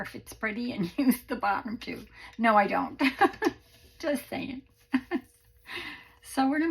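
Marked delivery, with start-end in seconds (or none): 3.0-3.6 bright laugh. Real, not acted. Laughs at her own joke.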